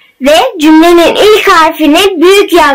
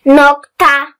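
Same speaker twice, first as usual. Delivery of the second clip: slow, word by word with long pauses; dictating; slower than usual. Shown as a demonstration. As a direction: read it out slowly for pupils to write down. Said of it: "Ben metni yavaş yavaş okuyacağım".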